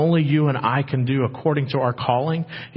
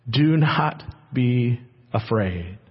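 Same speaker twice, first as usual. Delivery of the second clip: slow reassurance; 5 semitones lower, 3 dB quieter, much slower than usual. Acted. God reassuring Isaac.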